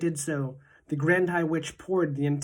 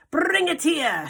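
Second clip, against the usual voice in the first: high, loud witch's shout; Grand High Witch shouting; 12 semitones higher, 5 dB louder, slower than usual. A narrator's reading. Witch leader shouting at her witches to seize the boy. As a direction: bark the order at the pack. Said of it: "shouted the Grand High Witch".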